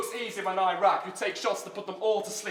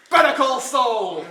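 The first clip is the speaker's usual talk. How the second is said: loud, drawn-out yell, pitched up; shouted punchline; 4 semitones higher, 11 dB louder, slower than usual. Real, not acted. Battler lands a punchline on his rival, shouted. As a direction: belt out the punchline, stretching each word.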